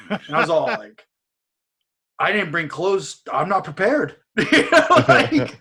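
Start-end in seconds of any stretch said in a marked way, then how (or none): 2.2-4.2 mock panic. Mock panic about visit.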